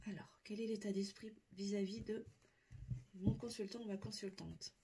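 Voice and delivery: hushed whisper to the guides. Real, not acted. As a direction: breathe the question, barely voiced. Asks the guides privately.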